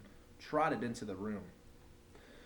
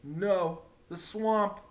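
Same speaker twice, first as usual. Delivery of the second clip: higher, louder frightened cry; frightened kids; 7 semitones higher, 6 dB louder, much slower than usual. A narrator's reading. Kids recognising the swamp, frightened wail. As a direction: wail it in dismay. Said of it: "cried the kids".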